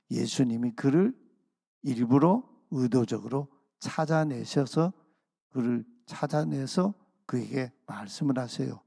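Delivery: usual speaking voice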